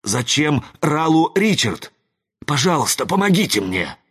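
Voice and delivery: high-pitched urgent plea; desperate pleading. A narrator's reading. Wizard asks parents' spirits for help, desperate pleading. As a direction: beg for help before they vanish.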